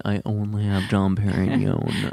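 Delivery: slow, posh-snob put-on voice. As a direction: play a snooty rich person.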